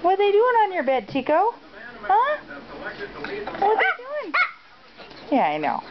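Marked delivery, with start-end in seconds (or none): 0.0-4.3 high pet voice. Real, not acted.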